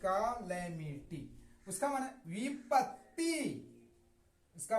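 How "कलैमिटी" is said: slow कैलेमिटी with 'kuh' then open 'lae'; correct pronunciation. Shown as a demonstration. Teacher sums up the drill, correct pronunciation. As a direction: say कैलेमिटी as 'kuh-LAE-mity', syllable by syllable. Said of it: "इसको पढ़ेंगे ले".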